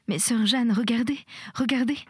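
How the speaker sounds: high, urgent plea; anxious pleading. A narrator's reading. Panicked patient urges nurse Jeanne to look out, pleading. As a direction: beg her to look, pitch lifted.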